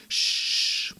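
gentle hush; soft shush. A narrator's reading. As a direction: shush him softly to calm him. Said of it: "machte sie leise". (leises beruhigendes Schhh)

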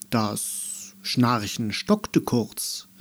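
ordinary delivery, nothing marked

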